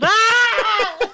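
piercing mock dog scream; imitated dog scream. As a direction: shriek like the panicked poodle. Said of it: "she could open her mouth".

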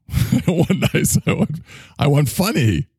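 put-on high, petulant childish whine; whiny demand. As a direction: whine like a spoiled kid. Demanding funny, not nice.

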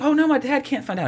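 high-pitched mock teenage girl; mock daughter. Boyfriend hears the daughter panicking, in a high mock girl voice.

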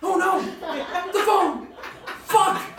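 loud, high panicked cries; panicked cry. Acted. Man who just threw his phone away, panicked shout. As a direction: realize too late the phone is gone.

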